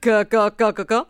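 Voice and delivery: crow cawing. Acted. Bird in-law cawing.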